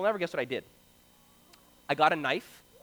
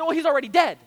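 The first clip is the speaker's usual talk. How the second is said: put-on matter-of-fact kid; 6 semitones higher, 6 dB louder, faster than usual. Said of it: "And I said".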